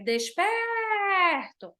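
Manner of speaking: "Desperto" slow with "pé" stressed; stress on the tonic syllable. Shown as a demonstration. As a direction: draw out "Desperto", leaning on "pé". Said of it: "a sílaba tônica é pé". ("Desperto" devagar, com ênfase em "pé")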